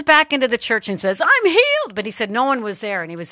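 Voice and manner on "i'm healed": much higher, elated announcement; triumphant exclamation; joyful cry. Retold or imitated. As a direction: burst in with amazed joy. Runs into church shouting.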